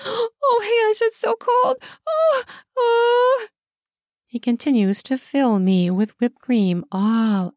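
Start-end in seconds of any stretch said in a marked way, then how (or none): 0.0-3.5 very high squeal at the cold. A narrator's reading. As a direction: flinch and squeal through the words. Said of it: "flinch and squeal from the cold".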